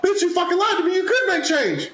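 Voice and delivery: high-pitched indignant outburst; outraged mock protest, voice way up; retold angry accusation. Acted accusation of lying.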